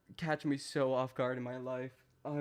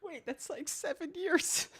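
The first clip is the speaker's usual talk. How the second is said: high, squeaky voice breaking into laughs; real helpless laughter pushing the pitch way up; crying with laughter; 11 semitones higher than usual. Real, not acted. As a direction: try to talk while laughing yourself to tears. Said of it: "I'm crying".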